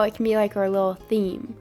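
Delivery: ordinary talk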